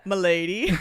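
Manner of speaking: smarmy milady. Acted. Suggesting a voice for Paris.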